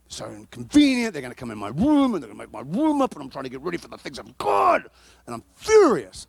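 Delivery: hammed-up indignant rant, swooping in pitch; furious tirade. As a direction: re-enact a petulant fit, bursting out on key words. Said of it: "And I was furious".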